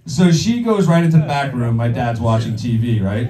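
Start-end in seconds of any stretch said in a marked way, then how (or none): none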